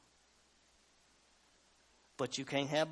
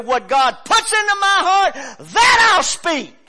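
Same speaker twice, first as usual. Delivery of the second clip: much higher, loud, slow declaration; defiant shout; 16 dB louder than usual. Retold or imitated. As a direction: prophet defying the kings. Prophet's defiant reply.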